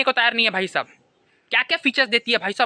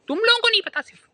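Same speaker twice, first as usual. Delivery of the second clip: mock indignation; 11 semitones higher than usual. Mock defending the Omni.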